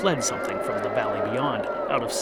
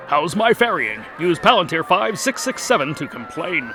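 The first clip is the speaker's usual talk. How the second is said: ferryman; 6 semitones higher, 6 dB louder than usual. Acted. Ferryman reads back tag.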